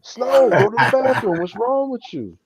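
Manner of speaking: high, loud exasperated pleading; exasperated plea. Retold or imitated. Owner losing patience with the dog in the snow, high exasperation. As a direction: beg the dog in frustration, voice up high.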